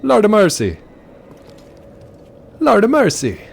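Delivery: put-on high, loud exclamation in a Jamaican accent that drifts Irish; a hammed-up despairing cry in accent; mock Jamaican accent. Delivery guy imagined judging Grandpa Joe, mock accent. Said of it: "what do you think about Grandpa Joe".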